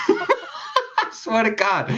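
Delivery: high, slow words through laughter; real laughter. Real, not acted. Teller swears his embarrassing hand-holding story is true, laughing.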